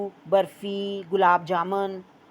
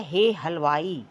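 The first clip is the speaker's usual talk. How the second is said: slow हे हलवाई with हे stressed; teacher reading हे हलवाई slowly for children; slow model reading; 3 semitones lower, slower than usual. Shown as a demonstration. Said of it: "मेरे साथ पढ़ें".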